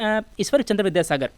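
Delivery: usual speaking voice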